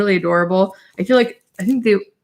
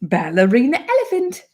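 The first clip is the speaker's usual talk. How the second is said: put-on slow, higher storybook British accent; 10 semitones higher, slower than usual. Mom reads a potty book to her daughter, British accent. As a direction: read it like a cheery British picture book.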